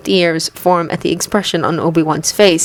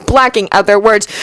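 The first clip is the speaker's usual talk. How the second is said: ordinary delivery, nothing marked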